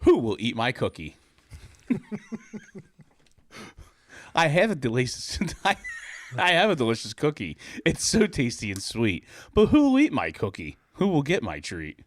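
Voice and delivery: a sing-song, rhyming storybook read; mock-innocent children's storybook reading. A narrator's reading. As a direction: read it like a picture book at story time. Reading adult kids' book aloud.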